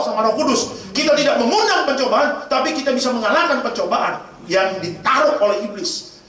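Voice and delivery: high, insistent sermon delivery; emphatic preacher building the point; forceful preaching. Real, not acted.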